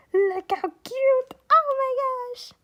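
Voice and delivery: loud, very high squeal; excited squeal. Real, not acted. Showing viewers the cute art, squealing with delight. Fangirling over the artwork.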